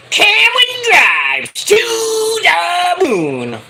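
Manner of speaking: high, loud, stretched-out wrestler's holler; hyped catchphrase shout. Acted. Host mimicking Cameron Grimes's catchphrase, long high shout. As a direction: shout it sky-high, dragging out the vowels.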